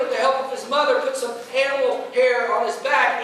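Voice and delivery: ordinary talk, nothing marked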